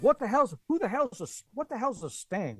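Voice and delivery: high-pitched Southern cop voice; Southern accent. Retold or imitated. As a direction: imitate a baffled Southern policeman. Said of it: "with this Southern accent".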